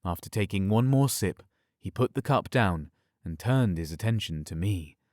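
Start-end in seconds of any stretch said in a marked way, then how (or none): none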